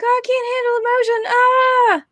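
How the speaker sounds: very high, hammed-up wail; mock-overwhelmed, held high pitch; overwhelmed heroine. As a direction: wail it like a melodramatic cartoon heroine. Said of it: "and then she's like".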